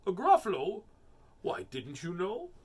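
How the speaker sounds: rising, lilting storybook mouse; mouse character. Acted. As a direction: feign surprise as the mouse. Voices the mouse.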